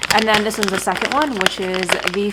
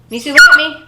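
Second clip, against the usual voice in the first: firm commands; 2 semitones higher, 10 dB louder than usual. Real, not acted. Training the puppy with a treat.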